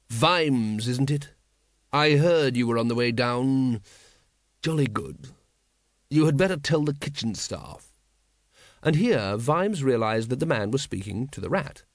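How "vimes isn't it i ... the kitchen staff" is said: a lightly voiced unruffled lord; cool, polite nobleman; calm aristocrat. A narrator's reading. Patrician greets Vimes calmly.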